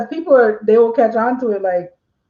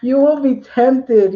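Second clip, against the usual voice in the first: normal talking voice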